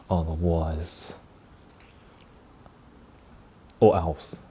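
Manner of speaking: slow, hushed mock menace; mock threatening. Vlogger playfully warning viewers to subscribe, mock menacing. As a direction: let the playful threat hang. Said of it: "threatening is the best way".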